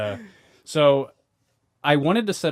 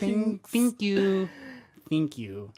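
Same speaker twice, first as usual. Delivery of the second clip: high, very slow thank you; mock girly thank you; 6 semitones higher, 5 dB quieter, much slower than usual. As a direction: drag it out like the viral girl. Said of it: "she goes".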